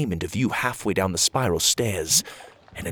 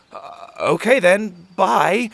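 awkwardly; 10 semitones higher, 6 dB louder, slower than usual. Acted. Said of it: "Pocket said awkwardly".

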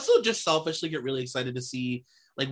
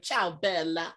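put-on Italian accent; 4 semitones higher, slower than usual. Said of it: "She's like".

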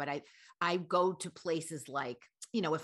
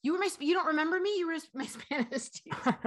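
high, fast, louder insisting; insistent fan; 11 semitones higher, 4 dB louder than usual. Retold or imitated. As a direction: press the point, talking fast and high. Fan insists, laughing, excited.

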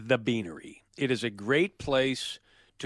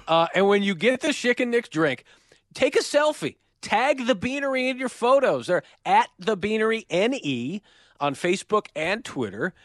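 higher-pitched, hyped sponsor pitch; ad read; 7 semitones higher than usual. Acted. Host telling customers to tag the sponsor, hyped announcer style. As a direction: push each instruction like an announcer.

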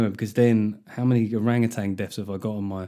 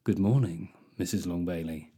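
low, soft, suggestive greeting; different intonation; 6 semitones lower, 6 dB quieter than usual. Acted. Man greeting a woman he has a thing for, low suggestive intonation. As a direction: drop it low and softer, like a chat-up. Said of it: "do it in a slightly different intonation".

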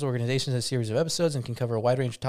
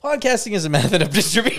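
laughing; 7 semitones higher, 6 dB louder than usual. Real, not acted. Laughing reading.

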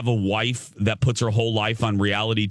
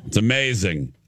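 usual speaking voice

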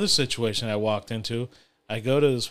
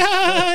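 mock high squeal; 7 dB louder than usual. Imitating the guys' squeal while running.

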